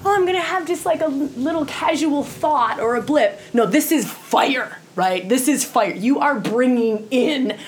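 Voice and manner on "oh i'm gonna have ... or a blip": lightly higher, breezy throwaway tone; mock casual shrug of a thought; dismissive mock quote. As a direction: brush it off like it's nothing. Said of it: "This isn't just".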